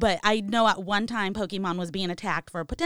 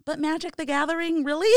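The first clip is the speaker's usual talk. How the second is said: incredulous; 7 semitones higher, 3 dB louder than usual. Real, not acted. Mocking parents' fear of card game.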